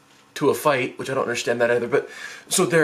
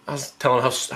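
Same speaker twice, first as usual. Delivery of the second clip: usual speaking voice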